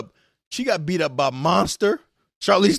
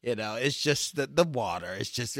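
sleepy pajama man voice; 5 semitones lower, 7 dB quieter than usual. Pretend. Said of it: "give me the voice".

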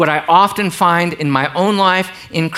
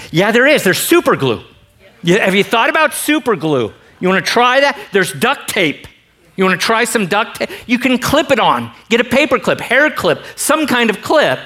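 higher, bright pushy suggestions; mock-cheerful; 7 semitones higher than usual. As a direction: needle him with over-eager fixes. Mock-cheerful answer to gloomy friend.

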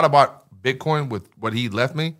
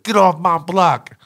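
put-on kid's tough threat; 7 semitones higher, 5 dB louder, slower than usual. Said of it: "he was like".

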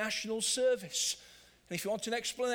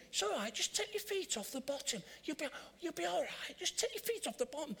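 put-on higher, softer coaxing to a child learning to swim; coaxing parent; 6 semitones higher, 5 dB quieter than usual. Parent coaxing kid in the pool, higher and soft. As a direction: gently coax a nervous child.

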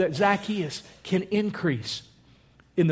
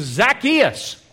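Jesus calling the name, higher; 6 semitones higher than usual. Retold or imitated. Jesus calls Zacchaeus by name, calling out. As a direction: call it up to someone in a tree. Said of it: "But he says".

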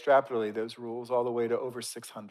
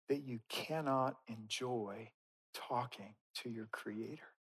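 soft, slow, with long pauses; earnest quiet plea; 9 dB quieter, slower than usual. Real, not acted. Preacher urges congregation against making rules, hushed and slow. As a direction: plead softly, phrase by phrase.

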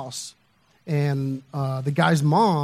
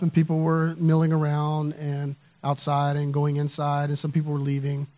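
usual speaking voice

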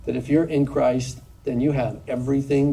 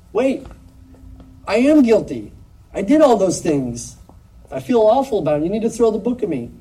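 much higher and louder, pleading to be condemned; desperate protest; 7 semitones higher, 6 dB louder than usual. Acted. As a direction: beg the judge to punish you, voice straining high. Sinner protests guilt before God.

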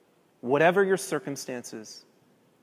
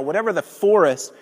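ordinary talk

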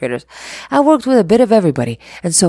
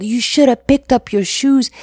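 stern parent scolding, louder and higher; mock-serious parental telling-off; big old deal; 6 semitones higher, 4 dB louder than usual. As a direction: bark it at the kid like it's a crisis. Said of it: "and I make a big old deal".

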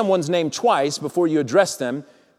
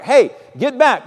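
sharp, louder, higher call; brisk command; 7 semitones higher, 5 dB louder than usual. Acted. Farmer prodding a stopped ox, brisk command. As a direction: call out to move them along.

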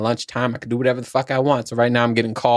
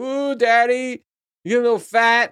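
put-on teasing kid; 11 semitones higher than usual. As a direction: tease daddy like a cheeky child. Kids voiced teasing dad.

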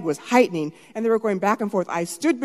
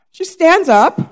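ordinary delivery

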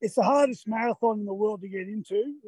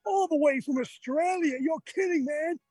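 higher-pitched imitation of cheering locals; excited fans; 7 semitones higher than usual. Imitating roadside fans cheering an Australian runner, lightly excited. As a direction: mimic a thrilled fan, lightly voiced. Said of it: "they were going crazy, going".

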